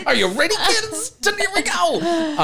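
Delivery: very high cartoon announcer, stretching the last phrase; exaggerated high-pitched kids' TV hype; Nickelodeon commercial voice. Mimicking a beach cleaner's videos, Nickelodeon commercial voice. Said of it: "Nickelodeon commercial vibe".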